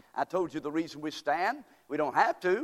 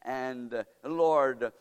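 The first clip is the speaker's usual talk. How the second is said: prayer; 2 semitones lower than usual. Acted. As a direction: pray gently and humbly. Leads congregation in prayer.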